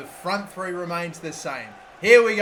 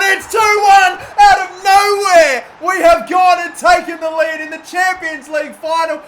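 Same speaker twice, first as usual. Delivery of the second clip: goal celebration; 10 semitones higher, 13 dB louder than usual. Real, not acted. Go-ahead goal in final.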